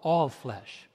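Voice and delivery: ordinary delivery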